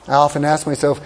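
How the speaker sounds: ordinary talk, nothing marked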